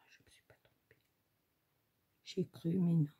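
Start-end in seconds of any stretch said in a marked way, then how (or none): 0.1-1.0 breathy self-check under her breath; hushed aside to herself; whisper to herself. Real, not acted. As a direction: whisper it, mostly to yourself. Muttering to herself while checking.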